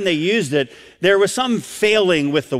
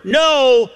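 NO high and loud; a forceful, raised "no"; emphatic no; 7 semitones higher, 5 dB louder than usual. Shown as a demonstration. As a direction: punch "no" above everything around it. Same emphatic word demonstrated.

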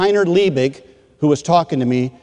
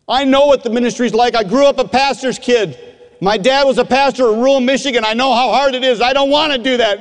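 high, strained retold outburst; tearful protest; 8 semitones higher than usual. Student crying to his mentor, tearful protest. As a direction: plead it pitched high, as if through tears. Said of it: "I started crying like I've never cried before".

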